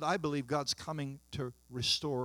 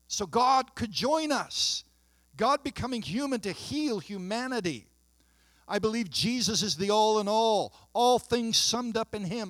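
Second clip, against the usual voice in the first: rising, fervent sermon delivery with higher pitch; impassioned preaching; 6 semitones higher, 5 dB louder than usual. Acted. Pastor preaching his belief to the congregation, fervent.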